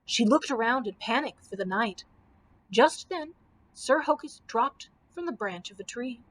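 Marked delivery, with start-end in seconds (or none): none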